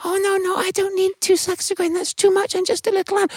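very high tiny voice; a tiny ant politely refusing; squeaky modest no. Acted.

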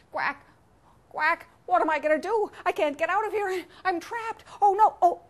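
high-pitched, fretful quacking; panicked duck. Acted. Duck trapped at the bottom of the well, high panic. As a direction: voice climbs as the panic grows.

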